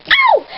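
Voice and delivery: sudden high yelp of pain; high cry of pain. Acted. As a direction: yelp like you've been jabbed. Poked, cries out in pain.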